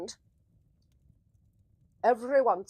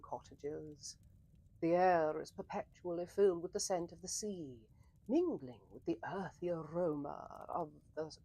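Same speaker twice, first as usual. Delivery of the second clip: hushed story narration; 3 semitones lower than usual. Acted. Scene setting narration.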